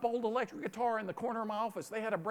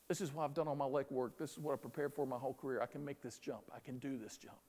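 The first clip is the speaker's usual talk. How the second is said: low, soft, steady self-assurance; quiet self-belief; 6 semitones lower, 7 dB quieter than usual. Acted. Stranded climber psyching himself up for the jump, quietly confident. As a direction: talk yourself into it under your breath. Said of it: "if he engaged in precursive faith".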